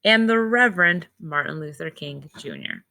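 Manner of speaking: clear, measured read-aloud storybook voice; reading to children. Acted. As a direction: read the book to the class slowly. Teacher reads picture book aloud.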